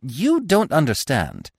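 mild indignation, drawn out; scolding squirrel. A narrator's reading. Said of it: "the squirrel went on scolding".

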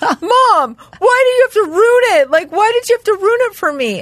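high, loud tearful whine, imitated; mock crying. Mother imitates son accused in the game, crying complaint. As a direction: wail it like an upset kid, hammed up. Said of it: "He starts crying and he's like".

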